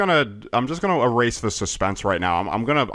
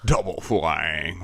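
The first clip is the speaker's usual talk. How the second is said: slow, low gloating villain; mustache twirling villain; 6 semitones lower, slower than usual. Pretend. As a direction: relish the words like a villain. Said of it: "And your Kami has double flying".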